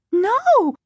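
high, loud shriek of horror; mother sees two husbands and screams; horrified scream. Acted. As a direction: scream it in terror. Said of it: "she screamed".

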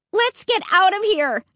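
high, urgent call; shout. A narrator's reading. Narrator shouts to the family to flee the park. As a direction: call out high to get everyone moving. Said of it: "I shouted".

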